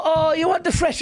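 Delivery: high, fawning waiter voice; overly affectionate waiter. Retold or imitated. Waiter fussing over a woman on a date, overly affectionate. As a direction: gush over the diner in a high put-on voice. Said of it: "he's the overly affectionate waiter".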